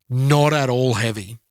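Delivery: usual speaking voice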